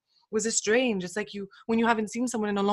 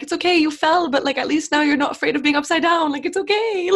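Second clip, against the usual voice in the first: reassuring; 7 semitones higher, 5 dB louder than usual. Retold or imitated. Reassuring a classmate who fell.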